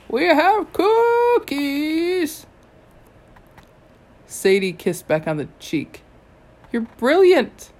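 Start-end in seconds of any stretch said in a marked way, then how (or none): 0.1-2.5 high, loud, very slow drawn-out call; yelling across river. A narrator's reading. Old god Ra calls to the heroes on shore, high, loud and slow. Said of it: "he yelled across the river". 6.7-7.7 loud, higher burst of delight; thrilled. Acted. Sadie praises the dwarf in a loud, higher burst of delight.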